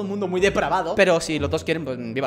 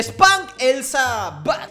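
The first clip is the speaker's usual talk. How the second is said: drawn-out slow read; 3 semitones higher, much slower than usual. Real, not acted. Gamer reading a game title, slow. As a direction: stretch the title out.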